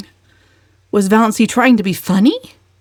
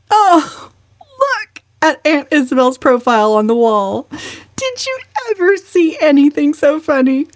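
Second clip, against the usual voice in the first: higher, bright gleeful exclaiming; 9 semitones higher than usual. A narrator's reading. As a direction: point it out with mischievous delight. Valancy gleeful at profile.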